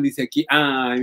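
normal talking voice

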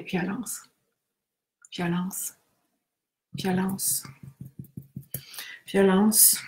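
quiet, even repeated word; soft tapping recitation. Acted. Leader taps eight points, softly. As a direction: murmur violence on each point.

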